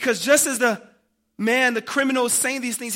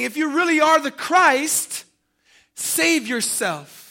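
loud, high taunt, last words drawn out; mocking; 6 semitones higher, 7 dB louder, slower than usual. Soldiers daring the crucified king, mocking. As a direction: taunt him forcefully, then slow right down. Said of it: "they scoffed at Jesus".

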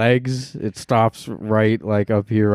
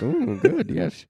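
high, pleased ooh good yes; mock blissful; 7 semitones higher, 4 dB quieter, slower than usual. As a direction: coo like it's bliss.

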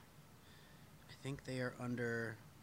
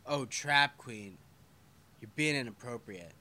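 mock scolding; 7 dB louder than usual. Real, not acted. Scolding viewer for skin pic.

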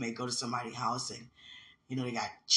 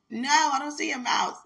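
put-on high, loud child's answer called back; son's reply; 14 semitones higher, 10 dB louder than usual. Son answering his mother from the bathroom, high and loud. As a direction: call back like a kid from another room.

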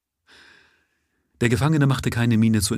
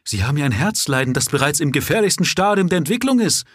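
louder, higher and fast, shocked by what he hears; alarmed exclamation; 8 semitones higher, 4 dB louder, faster than usual. Acted. Doctor shocked to find the prisoner's heart disease, loud and fast. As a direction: blurt it out in alarm.

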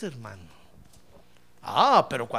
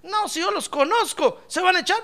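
much higher, insistent acted retort; Joshua doubting them; 11 semitones higher than usual. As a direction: challenge them in a high voice. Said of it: "Josué les dijo". (réplica aguda y desconfiada)

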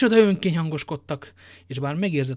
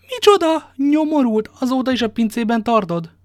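much higher, louder furious outburst; outraged shout; 9 semitones higher, 8 dB louder than usual. Acted. D'Artagnan furiously rounds on the innkeeper, shouting. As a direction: blast him with outrage.

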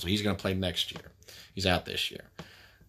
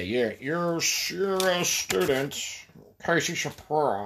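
mocking voice; 7 semitones higher, slower than usual. Mocking someone's voice.

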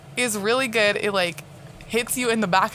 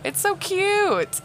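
gushing; 13 semitones higher, much slower than usual. Real, not acted. Gushing about kid she nannies.

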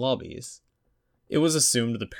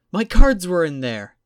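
loud high panicked wail; panicked outburst; 10 semitones higher, 11 dB louder than usual. A narrator's reading. Jennifer to Alan, loud and panicked. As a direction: blurt it in rising panic.

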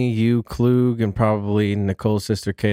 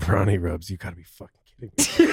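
low, muttered disbelief at a name; low-key incredulous announcer; skeptical announcer; 6 semitones lower, 3 dB quieter than usual. Acted. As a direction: read out a name, then doubt it under your breath. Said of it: "get more and more skeptical of the name".

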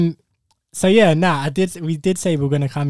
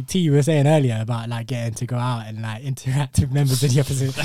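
ordinary talk, nothing marked

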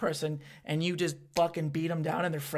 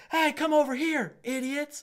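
high heckling shout to passers-by; imitated calling out; 10 semitones higher, 4 dB louder, slower than usual. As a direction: yell it out to pull people over. Calling passers-by over to show.

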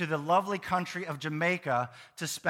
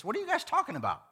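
higher, quick, smirking question; 6 semitones higher, 3 dB quieter, faster than usual. Acted. Preacher imagines Jesus teasing two walkers, smirking. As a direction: ask it lightly through a smirk. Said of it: "with a smirk on his face".

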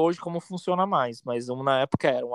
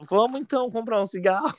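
coy retold invite; 8 semitones higher than usual. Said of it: "eu vou assim".

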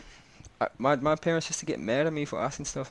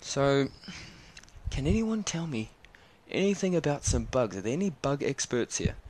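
higher, chirpy teacher question to the class; a hint of a cheery guide asking kids; lightly voiced zoo guide; 4 semitones higher than usual. Zoo guide quizzes the class, lightly voiced and high. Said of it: "he goes".

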